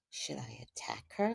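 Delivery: whispered question to spirit; hushed, retold inner voice; secretly asking. Privately consulting spirit in story.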